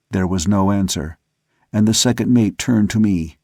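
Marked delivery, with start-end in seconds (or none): none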